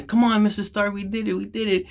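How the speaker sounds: a hint of a desperate kid begging; pleading Spider-Man.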